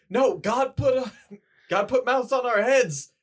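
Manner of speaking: loud, high mock outcry; indignant protest. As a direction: shout it in mock outrage. Acting out honeymoon husband's outraged protest.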